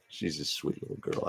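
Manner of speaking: whispered, tender and hushed; soft whisper. Real, not acted. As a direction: breathe it softly about the dog. Cooing about her dog Sasha.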